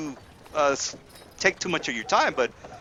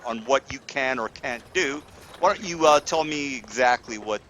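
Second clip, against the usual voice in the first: ordinary talk, nothing marked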